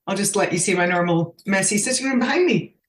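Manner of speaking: ordinary talk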